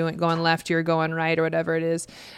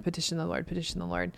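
quiet refrain; 9 dB quieter, faster than usual. Shown as a demonstration. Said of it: "repeated refrain softer".

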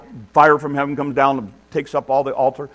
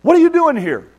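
mock-angry shout, pitched very high; loud, high, indignant mock rebuke; imagined scolding God; 13 semitones higher, 6 dB louder than usual. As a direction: yell it like an exasperated boss, hammed-up. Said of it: "That's not what God does".

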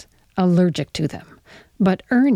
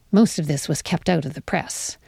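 ordinary delivery, nothing marked

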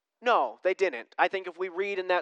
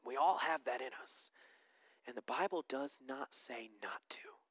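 hushed; 6 semitones lower, 14 dB quieter than usual. Real, not acted. Hushed sermon stretch.

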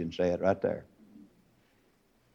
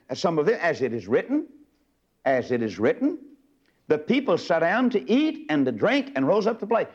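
preach; 3 semitones higher than usual. Acted. Reading scripture in sermon cadence.

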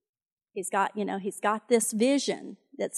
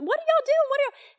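put-on outraged young David; 5 dB louder than usual.

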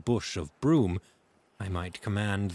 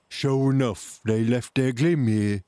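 raised, loud sailor's voice; pirate calling down; 3 semitones higher, 5 dB louder than usual. A narrator's reading. Fellow at window to the gang, loud call.

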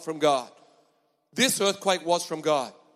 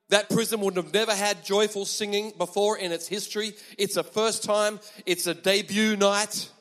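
usual speaking voice

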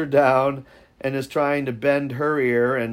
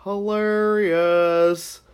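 drawn out; 5 semitones higher, much slower than usual. Real, not acted. Drawn-out reaction.

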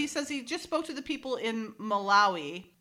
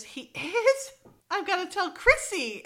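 high, excited mock shock; mock gossipy surprise; 10 semitones higher, 3 dB louder than usual. Tennis star thrilled by gossip, high mock surprise. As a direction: gasp it out high and eager to spread it.

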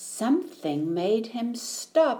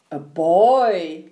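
put-on loud yell, lower than the boy's; bear's yell; 3 semitones lower, 7 dB louder than usual. A narrator's reading. Bear coming face to face with a boy, yelling. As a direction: yell it out as a startled storybook bear. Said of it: "yelled the bear".

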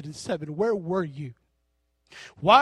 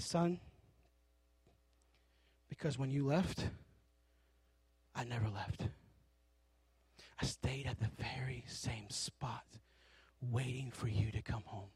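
soft, low loving father whisper; God's tender voice; 6 semitones lower, 13 dB quieter than usual. Acted. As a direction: answer gently like a father calling home. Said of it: "He said".

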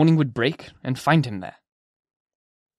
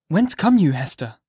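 higher, a little louder question; the minister asking; 3 semitones higher, 4 dB louder than usual. Acted. Minister questioning Hester, higher and louder. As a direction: ask her where she comes from. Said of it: "asked the minister".

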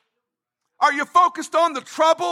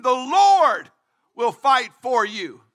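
ordinary delivery

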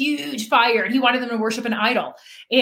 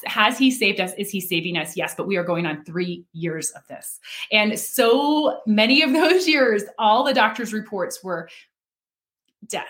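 normal talking voice

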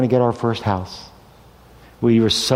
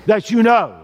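God speaking; 8 semitones higher, 4 dB louder than usual. Acted. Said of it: "God says to Abraham".

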